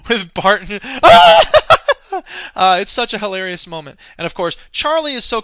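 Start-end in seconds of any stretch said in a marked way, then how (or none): 0.9-2.5 high, loud burst of real laughter. Real, not acted. Laughs at a hilarious moment.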